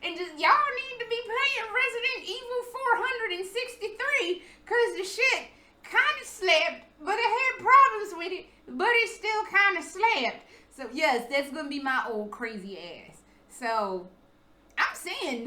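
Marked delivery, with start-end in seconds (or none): 0.4-10.4 put-on old lady gushing. Future old self ranting.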